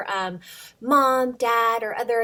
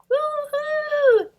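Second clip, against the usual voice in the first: excited cheer; 5 dB louder than usual. Real, not acted. Celebrates end of book.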